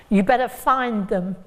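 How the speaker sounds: ordinary delivery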